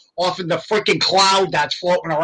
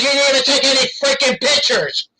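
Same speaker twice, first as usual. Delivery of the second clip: mock exasperated uncle; 9 semitones higher than usual. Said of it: "He goes".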